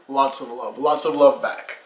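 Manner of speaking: usual speaking voice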